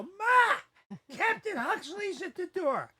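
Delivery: put-on very high, loud child's holler; excited yelling. Boy calls his mother to the door, frantic excited yell. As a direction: scream for Mom, stretching it out.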